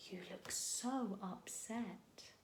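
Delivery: whispered. A narrator's reading. Classmates teasing Bill, slow whisper. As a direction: breathe it teasingly into his ear. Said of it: "they whispered".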